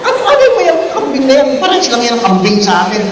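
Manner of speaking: loud, high, incredulous outburst; acted high-pitched ranting; mock exasperation. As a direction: scoff loudly at the tourist's photos. Acted scolding over goat photos.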